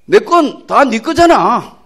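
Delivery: slow, loud Gyeongsang dialect father. Acted. Father answers elder son in slow, loud Gyeongsang dialect. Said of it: "이 경상도 말이라".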